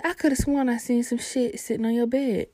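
lightly voiced worried mother; imitating mom. Mom's voice in story.

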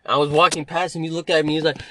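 ordinary delivery

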